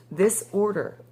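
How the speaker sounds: usual speaking voice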